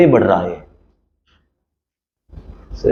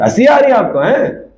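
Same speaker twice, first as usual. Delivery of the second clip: mock taunt; 12 semitones higher, 3 dB louder than usual. Mocking someone laughing at him.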